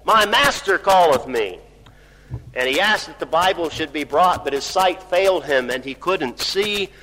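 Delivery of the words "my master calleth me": loud, high shout, easing slightly at the end; sudden cry. Retold or imitated. Dying Robert Bruce calling to his daughter at breakfast, crying out. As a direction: cry out with drawn-out "hold". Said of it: "suddenly he cried out".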